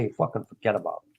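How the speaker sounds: mock mobster. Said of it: "you say".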